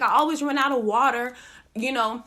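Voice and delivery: usual speaking voice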